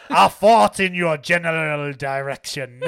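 put-on loud taunt in an outrageous French accent; mock French accent. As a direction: hurl it like a comic French insult. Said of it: "also in French, uh, the Holy Grail".

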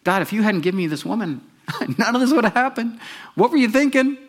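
high, laughing mock accusation; Adam accusing God. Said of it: "who's he blaming? God".

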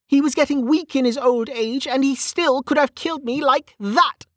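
high-pitched frustrated character voice; Velika, frustrated. Acted.

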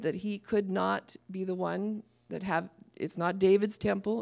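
ordinary delivery

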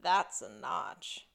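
slow, low Crocodile Dundee parody reveal; pause, then land THAT'S slowly and low; movie-line parody. Retold or imitated. Host quoting a man finding the mark, slow low parody. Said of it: "pop culture reference here where he's like".

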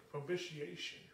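ordinary talk, nothing marked

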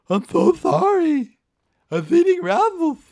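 very high, loud Hulk-like oaf; Paul. Retold or imitated. Drunk green-painted buddy apologizing to friend's mom, weird high voice. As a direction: apologize in a goofy high caveman voice. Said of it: "So we give him that voice".

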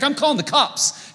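high mock threat. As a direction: announce it indignantly. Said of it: "he's like".

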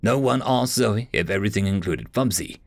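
plain reading voice

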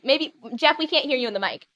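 ordinary talk

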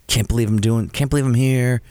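lightly acted amazement at being here; excited voice. Artist talking to the camera at the club, mildly excited. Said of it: "talks in an excited voice".

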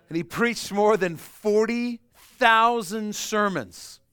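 loud, high, slow proclamation; big preacher's exclamation of awe; booming emphasis. Acted. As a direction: thunder out the number for the crowd. Preacher booms the big number.

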